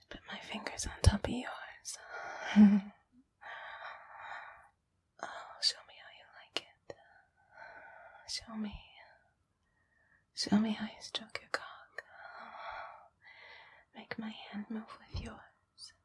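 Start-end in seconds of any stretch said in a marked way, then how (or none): none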